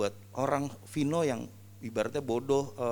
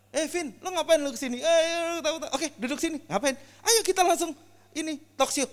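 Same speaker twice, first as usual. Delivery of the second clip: much higher, brisk hearty summons; eager pastor. Retold or imitated. Pastor pulling Vino on stage, high and eager. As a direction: grab him into the talk show. Pastor calling him over excitedly.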